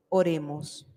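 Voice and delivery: ordinary delivery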